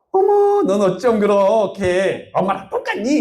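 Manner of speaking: high-pitched, drawn-out surprised exclamation; delighted amazement. Retold or imitated. Mother's friend marvels at how alike they look, amazed. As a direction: gush like an astonished family friend.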